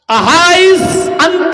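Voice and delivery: slow, high call in Arabic; calling out loud. Retold or imitated. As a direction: call after a man walking away, loud. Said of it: "پھر کہا، آواز دی".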